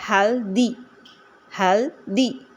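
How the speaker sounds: हेल्दी broken into हेल, दी each time; split syllables. Shown as a demonstration. Teacher reading healthy as an example, split syllables. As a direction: say हेल, pause, दी. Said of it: "syllables को अलग-अलग करने के लिए".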